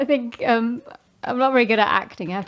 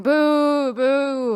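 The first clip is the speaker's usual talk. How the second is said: mock booing crowd; 6 semitones higher than usual. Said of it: "everyone's going".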